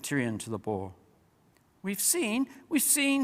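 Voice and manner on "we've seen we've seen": much higher squeaky mouse voices; squealing mice. A narrator's reading. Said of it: "squealed the mice".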